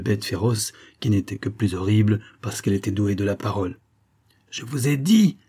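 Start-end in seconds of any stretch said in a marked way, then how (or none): 4.5-5.4 higher, rising angry cry; howling. A narrator's reading. Enraged stranger struggling with the major, howling. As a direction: howl it with pitch climbing. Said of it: "hurlait-il".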